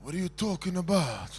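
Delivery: quiet, low, dismissive mumble; mock Italian gesture talk. Showing students the Italian brush-off, low and quiet. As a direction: shrug it off in a low voice. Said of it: "It's like this".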